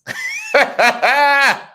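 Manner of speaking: a high burst of laughter. Real, not acted. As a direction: laugh out loud at the joke. Finds the comment funny.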